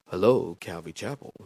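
low, soft phone greeting. Retold or imitated. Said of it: "That's my phone voice".

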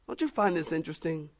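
ordinary talk, nothing marked

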